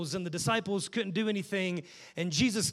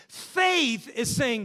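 usual speaking voice

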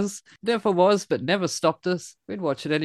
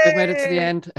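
high, celebratory call; excited cheer; 12 semitones higher, 4 dB louder, faster than usual. Real, not acted. Host wrapping up the long show, excited high cheer.

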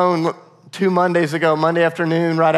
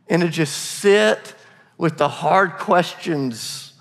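slow, deliberate emphasis; slower than usual. Real, not acted. Pastor urging listeners to linger.

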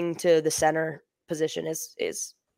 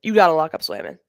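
speaking with candy in mouth; mouth full; 3 semitones lower than usual. Real, not acted. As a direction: lozenge muffling the words. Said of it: "I have a cough drop in my mouth".

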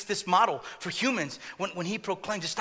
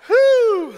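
high whew; 14 dB louder than usual. Real, not acted. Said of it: "I had an idea, but I didn't go there".

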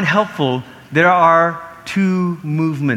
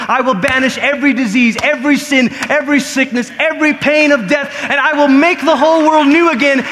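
high, forceful proclamation building louder; impassioned preaching as God; 7 semitones higher, 4 dB louder than usual. Acted. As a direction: declare God's promise with mounting fervor. Preacher voices God's promise, building.

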